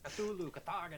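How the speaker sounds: loud, high Swedish chef chant; Swedish chef accent. Shown as a demonstration. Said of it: "Swedish speech, chef".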